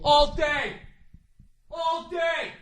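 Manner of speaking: high, hammed-up fan yelling; mock sports bar yell. Jersey-wearing fan yelling at your table, high mock yell. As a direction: bellow like an obnoxious bar fan. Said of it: "they yell at your table".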